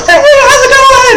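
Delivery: very high, slow tiny voice from far below; squeaky distant voice. Retold or imitated. Friend below calls up to the kid on the stool, very high and squeaky. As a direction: squeak it like a friend shouting up from the floor.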